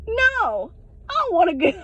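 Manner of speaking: high, whiny protest; sudden high-pitched mock refusal; retold refusal. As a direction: whine it out as a replayed refusal. Acting out her refusal to him.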